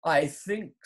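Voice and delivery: between his teeth. A narrator's reading. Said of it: "said it between his teeth".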